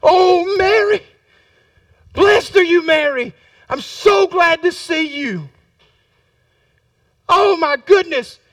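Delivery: high, gushing joyful welcome; excited greeting. Acted. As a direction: rush in overjoyed to see a dear relative. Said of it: "she goes running in the room".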